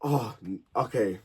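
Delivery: ordinary talk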